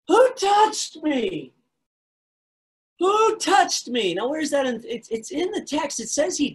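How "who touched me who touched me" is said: high, slow, loud desperate call; screaming. Acted. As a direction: call out across a crowd, high and drawn out. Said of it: "her screaming".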